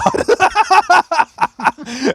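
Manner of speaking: laughing hard, up high; high burst of laughter. Real, not acted. Laughing at the friend's retold insult, high and loud. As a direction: crack up at the story.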